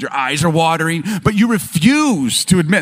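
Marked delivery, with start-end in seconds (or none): none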